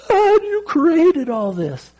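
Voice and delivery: high, slow, choked-up wonder; retelling a weeping prayer to God on a surfboard; tearful awe. Retold or imitated. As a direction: pour out amazement through tears. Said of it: "and I'm bawling, and I'm crying".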